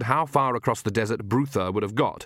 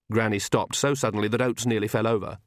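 usual speaking voice

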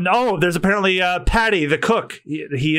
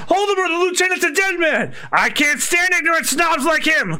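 higher, shouted threat; angry shout; 7 semitones higher than usual. Acted. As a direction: rage at the snob. Angry cook raging at customer.

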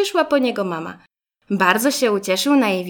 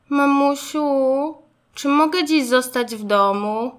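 put-on pleading child; 6 semitones higher, 3 dB louder than usual. A narrator's reading. Little Zygzak begging mama to stay home, pleading. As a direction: whine gently to get your way.

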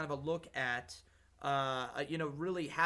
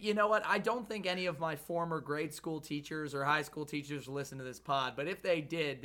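ordinary delivery

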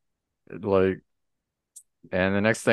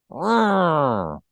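high, loud monster roar; giant alien booming voice; 8 semitones higher, 4 dB louder, slower than usual. Acted. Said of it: "Hulk smash".

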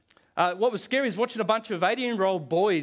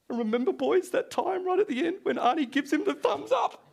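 mock teary recall; 11 semitones higher than usual. Pastor teasing congregation about crying at Terminator, high mock-emotional voice. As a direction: voice jumps much higher in mock emotion.

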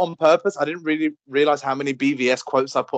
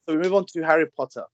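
normal talking voice

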